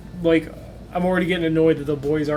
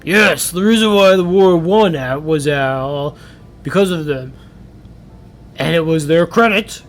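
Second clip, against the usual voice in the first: father character; 3 semitones lower, 5 dB louder, slower than usual. Acted.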